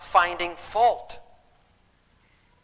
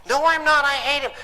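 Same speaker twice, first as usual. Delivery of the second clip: higher, indignant mock protest; acted defensive outburst; indignant retort; 6 semitones higher than usual. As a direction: snap back as the bitter woman. Acted retort of bitter woman.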